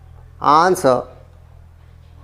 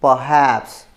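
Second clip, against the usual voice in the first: perhaps with a long, strong HAPS; stress on second syllable; 5 semitones lower than usual. Shown as a demonstration. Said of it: "syllable number टू है". (perhaps के दूसरे syllable पर ज़ोर)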